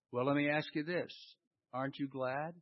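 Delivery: normal talking voice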